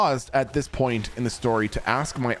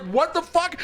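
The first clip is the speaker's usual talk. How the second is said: shocked. Retold or imitated. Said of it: "She was like".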